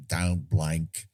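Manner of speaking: botched Cockney sliding into Australian; broad, low Australian-sounding accent; Michael Caine accent gone Australian. Retold or imitated. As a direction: attempt a British film star, land in Australian. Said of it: "an Australian accent".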